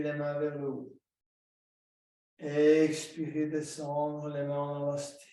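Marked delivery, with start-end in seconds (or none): 2.4-3.5 Expirez stretched slow and louder; drawn-out cue. Shown as a demonstration. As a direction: push the exhale out with a long Expirez. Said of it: "cueing a long exhale". (Expirez appuyé et allongé)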